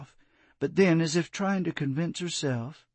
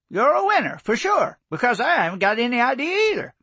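higher, louder, fast jovial tease; good-humored; 10 semitones higher, 5 dB louder, faster than usual. Acted. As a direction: toss it off with a grin. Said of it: "Jones said good-humoredly".

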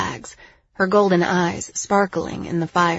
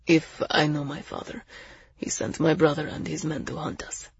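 hushed, sombre warning; grave Zell; 5 dB quieter than usual. Acted. Zell warning the group of hunters, grave.

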